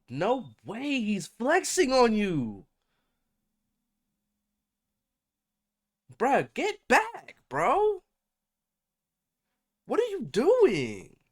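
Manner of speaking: high, loud hype-man urging; frat bro. Real, not acted. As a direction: egg him on like a hype man. Hyping Kevin to fight back.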